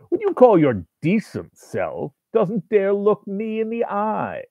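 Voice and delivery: high, slow, sneering voice; mock his decency slowly; sneering demon. A narrator's reading. Demon sneering at the writer's decent self, slow high sneer.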